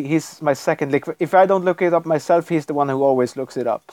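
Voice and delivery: ordinary talk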